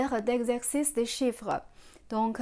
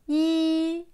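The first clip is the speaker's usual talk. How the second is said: "Yi" held high and level; first tone; 6 semitones higher, 5 dB louder than usual. Shown as a demonstration. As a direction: say "Yi" loud on one steady high pitch. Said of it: "Premier ton".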